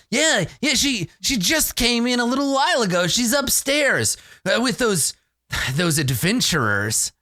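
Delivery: high-pitched, hesitant tavern patron; eager informant. Acted. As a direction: tip them off in a high voice. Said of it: "somebody downstairs is like".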